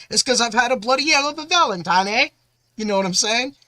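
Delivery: bad British accent, hammed up. Shown as a demonstration. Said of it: "My British accent isn't probably the best".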